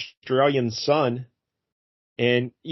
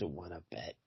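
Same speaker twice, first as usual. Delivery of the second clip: low, very soft dare; real quiet; 7 semitones lower, 22 dB quieter than usual. Retold or imitated. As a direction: barely breathe the challenge. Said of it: "Real quiet".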